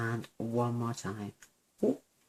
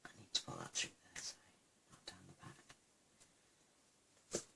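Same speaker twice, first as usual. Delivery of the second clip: whispered to herself; half-whispered self-correction while concentrating; muttered aside; 5 semitones lower, 22 dB quieter than usual. Real, not acted. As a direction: breathe the words out under your breath.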